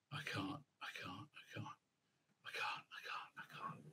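whisper: I can't, unvoiced; whispered despair. Real, not acted. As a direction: breathe it out in despair. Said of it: "It's too awful".

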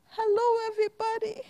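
usual speaking voice

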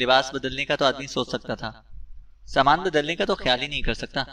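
ordinary delivery, nothing marked

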